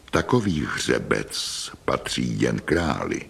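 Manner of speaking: low, measured royal sternness; stern. A narrator's reading. King repeats his adviser's words, in a stern voice. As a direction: repeat it low and severely, with weighty pauses. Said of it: "opakuje po něm přísným hlasem".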